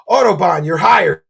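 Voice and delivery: booming you're hired; cheering at a donor; loud cheer. Real, not acted. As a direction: shout it like a game show host. Excited hiring shout to donor.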